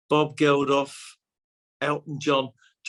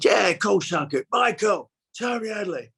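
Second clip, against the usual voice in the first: hammed-up Cockney, pitched higher; emphatic mock London accent; Bob Hoskins; 7 semitones higher than usual. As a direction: imitate a matey Londoner calling a friend over. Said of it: "it was not the best accent in the world".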